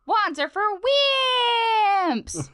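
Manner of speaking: high, loud boyish cartoon hero shout; Finn. Shown as a demonstration. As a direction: belt it high, drawing out the last word. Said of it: "I gotta say it in Finn voice".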